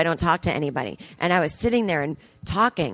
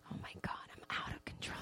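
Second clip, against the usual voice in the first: hushed unvoiced whisper; 23 dB quieter than usual. Comic telling her audience she's whispering to save her voice. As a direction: whisper it like a guilty secret. Whispering about being out of control.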